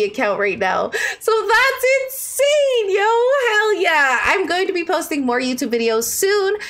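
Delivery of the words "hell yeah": ordinary delivery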